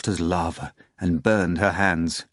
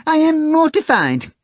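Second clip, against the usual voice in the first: very high, loud mock-horrified howl; a hammed-up high, loud cat howl; 6 dB louder, slower than usual. A narrator's reading. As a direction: wail as if mortified. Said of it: "howled the cat".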